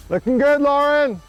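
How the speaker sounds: very loud, high praise call; shouting encouragement. Real, not acted.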